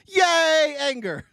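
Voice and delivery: high, squealing "yay, anger" in a mock cheer. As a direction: mimic her giddy delight at anger. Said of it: "She's like".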